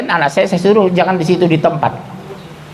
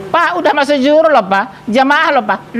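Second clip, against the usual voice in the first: wife calling; 9 semitones higher than usual. Retold or imitated. Wife calls husband to prayer.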